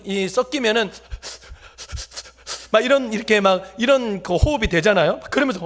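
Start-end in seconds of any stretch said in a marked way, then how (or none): none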